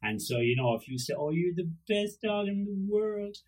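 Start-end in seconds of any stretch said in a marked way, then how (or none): 1.2-3.4 high, slow praise for a dog; drawn-out baby talk to a pet; doting inflection. Shown as a demonstration. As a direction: gush adoringly in a pitched-up voice. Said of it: "it's all in the inflection".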